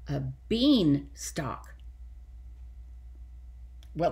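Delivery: ordinary delivery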